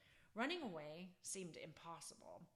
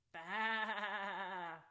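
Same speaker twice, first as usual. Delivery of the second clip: loud bleating sheep baa; 8 dB louder than usual. A narrator's reading. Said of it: "the strange sounds".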